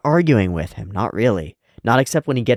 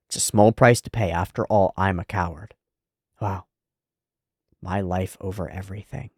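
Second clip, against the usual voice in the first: ordinary talk, nothing marked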